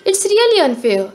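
high, whiny complaint; complaining. A narrator's reading. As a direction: protest the unfairness. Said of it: "Alessandro complained".

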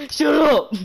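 loud, high burst; sharp shush. Real, not acted. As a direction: shout it to silence someone. Tells the other to be quiet.

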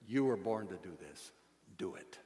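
low, soft repeat that sinks away; hushed charge. Real, not acted. Preacher closing his charge to listeners, quietly. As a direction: bring it right down for the closing exhortation.

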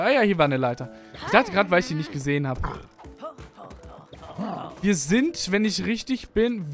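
ordinary delivery

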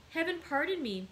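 high-pitched, anguished exclamation; crying out. A narrator's reading. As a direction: cry out for forgiveness. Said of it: "cried Edmond".

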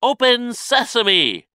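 high, slow, loud incantation; trying magic words. A narrator's reading. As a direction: call the spell out high and slowly. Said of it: "He tried the magic words".

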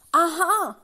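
high, loud exclamation; gleeful cry. A narrator's reading. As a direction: cry out on spotting them. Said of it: "cried Mister Tickle".

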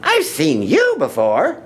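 high-pitched surprised recognition; startled bird's high voice; Maisie. A narrator's reading. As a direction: exclaim it high, swooping on the words. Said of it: "gasped Maisie".

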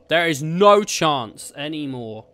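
normal talking voice

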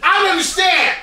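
loud dumb shout for the director; real loud and stupid. Shown as a demonstration. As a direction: holler for the director like a fool. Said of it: "I gotta say it real loud".

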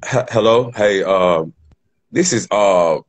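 hesitant stranger calling the hospital, deep voice; fake phone caller voice. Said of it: "I'm finna talk just like them".

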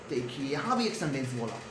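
ordinary talk, nothing marked

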